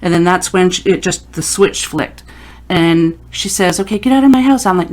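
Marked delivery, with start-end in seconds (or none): 3.8-4.7 higher-pitched, unconvincing mock order; half-hearted command. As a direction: imitate a client not yet using her mom voice. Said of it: "No, no, no, mom voice".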